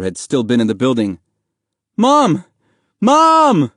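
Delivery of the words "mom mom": very high, loud calling out; desperate, high-pitched shout; frantic yell. A narrator's reading. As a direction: yell for a mother lost in the rubble. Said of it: "Mahmoud yelled".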